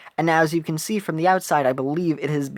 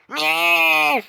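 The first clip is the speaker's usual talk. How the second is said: high nyeh; 11 semitones higher, 4 dB louder than usual. Acted. Character sound voiced.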